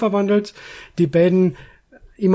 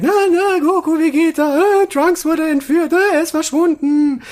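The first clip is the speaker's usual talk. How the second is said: panicked yell; 11 semitones higher than usual. Retold or imitated. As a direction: shout it in a high panicked voice. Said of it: "die halt schreit".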